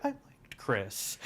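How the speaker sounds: slow and laughing; chuckling through mom's line. Retold or imitated. Quoting mom's reaction to the stabbing, laughing.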